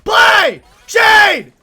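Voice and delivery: high, full-volume holler at a teammate; angry yell. Real, not acted. Player to idle Shade teammate, exasperated yell. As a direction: scream each word separately, falling off.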